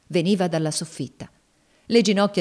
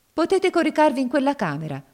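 taunting dare; 9 semitones higher, 4 dB louder than usual. Acted. Cassie dares Legree to sleep there.

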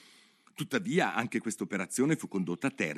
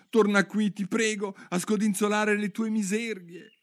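higher, louder call; Alice calls after the departing mouse, high and louder; 12 semitones higher, 4 dB louder than usual. Acted. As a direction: shout it after the leaving mouse. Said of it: "gli gridò dietro Alice".

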